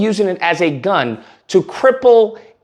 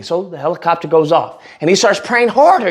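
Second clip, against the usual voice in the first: ordinary delivery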